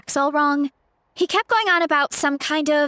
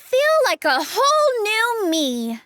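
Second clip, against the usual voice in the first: refreshed delight; 6 semitones higher, slower than usual. Acted. Refreshed after meal.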